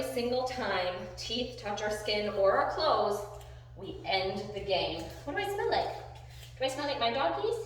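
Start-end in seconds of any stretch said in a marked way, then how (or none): 5.2-7.6 high, lilting talk to a dog; cooing pet talk. Real, not acted. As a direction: coo a playful question at your dog.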